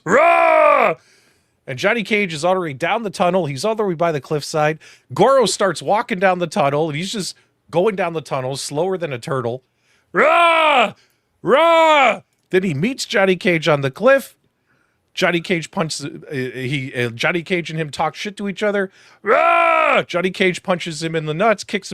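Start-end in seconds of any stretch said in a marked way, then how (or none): 0.0-0.9 single loud, high mimicked roar; mock monster roar. 10.1-12.2 loud, high mimicked Goro roar; three drawn-out roars mocking a movie monster. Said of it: "he roars before Johnny Cage hits him". 19.2-20.0 single loud, high mimicked roar; mock monster roar. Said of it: "he roars before Johnny Cage hits him".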